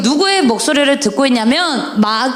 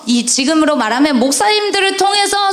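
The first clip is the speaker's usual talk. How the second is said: ordinary delivery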